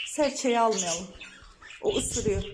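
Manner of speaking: ordinary talk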